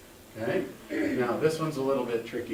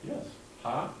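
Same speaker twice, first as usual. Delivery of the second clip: ordinary talk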